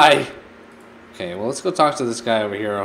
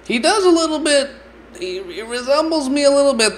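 high squeaky voice; 13 semitones higher than usual. Acted.

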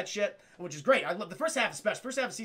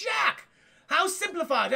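delighted; 9 semitones higher, 4 dB louder, slower than usual. Retold or imitated. Dogs delighted.

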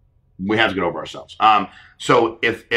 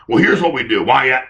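mock heated retort; 4 semitones higher, 7 dB louder than usual. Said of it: "you want me to go above you and go".

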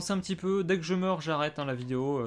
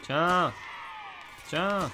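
gamer shout; 4 semitones higher than usual. Real, not acted. Tire sur un ennemi.

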